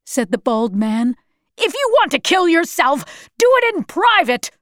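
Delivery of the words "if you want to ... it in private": high, harsh, exasperated outburst; angry scolding. A narrator's reading. Bald man berating the suicidal woman, angry scolding. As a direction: tell her off with pitch pushed up.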